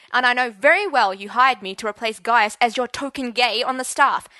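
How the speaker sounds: loud, angry tirade; furious defiance. Acted. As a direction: shout down the headmaster. Teacher defies headmaster, threatening.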